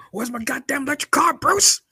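high, loud demanding shout; mock outraged yell. Demanding the electric car from Bruce, high yell.

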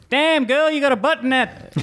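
high, far-off mock catcall voice; hammed-up leering whistle-voice. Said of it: "I was like".

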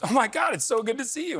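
high-pitched imitation of a gushing fan. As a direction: gush like a starstruck passer-by. Fan greets him in street.

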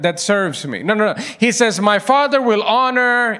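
normal talking voice

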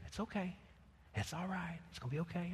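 calm the frightened dog gently; soothing pet. Retold or imitated. Owner soothing his scared German Shepherd puppy, hushed. Petted her and said.

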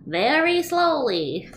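high, slow crazy voice. Acted. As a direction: drag it out like a madman. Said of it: "answer in a crazy voice".

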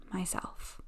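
breathy whisper to finish. Acted. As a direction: let the last word fall to a whisper. Soft close of affirmation.

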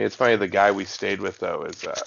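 usual speaking voice

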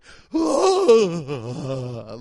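a loud, long ghostly wail; ghost scream. Retold or imitated. Comic re-enactment of screaming at a pigeon, loud ghostly wail. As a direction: let out a ghost-leaving-the-body shriek. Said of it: "I made, like, a ghost coming noise".

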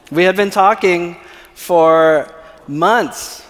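ordinary talk

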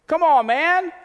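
high, loud scoffing come-on; mock exasperation. Preacher mocking the leaders' exasperation with a high, loud scoff. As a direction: scoff at him in disbelief.

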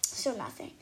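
ordinary delivery, nothing marked

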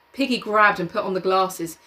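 usual speaking voice

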